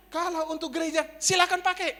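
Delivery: high and loud retold offer; eager generous offer. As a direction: imitate an emphatic, welcoming landlord. Landlord generously offers the place.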